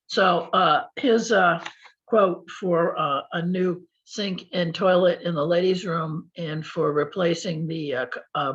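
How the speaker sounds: normal talking voice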